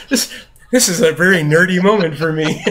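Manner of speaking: loud, high, laughing through the words; laughing delight. Retold or imitated. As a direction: gush with amazement while laughing. Overjoyed dad, laughing as he retells.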